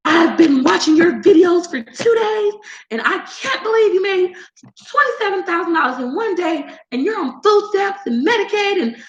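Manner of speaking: high, shrieking accusing voice; hammed-up angry commenter threatening to report; outraged viewer lady. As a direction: rant like an obsessed angry viewer. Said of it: "she was like".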